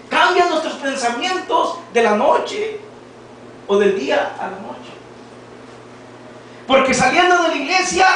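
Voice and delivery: fiery preaching. Acted. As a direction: call it out to the congregation. Preacher rises to full force.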